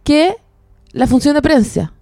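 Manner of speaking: slow, drawn-out puzzled question. Retold or imitated. Confused about the premiere date, asking slowly. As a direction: drag it out, baffled. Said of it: "Yo decía".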